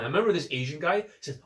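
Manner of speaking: normal talking voice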